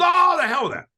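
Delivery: high, loud, brushing it off; dismissive shout. Retold or imitated. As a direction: wave it all away with a shout. Cliff dismisses advice, retold.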